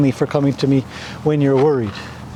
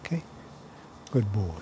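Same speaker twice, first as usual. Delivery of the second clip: quiet soothing; 7 semitones lower, 15 dB quieter than usual. Shown as a demonstration. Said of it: "And we'll get quiet".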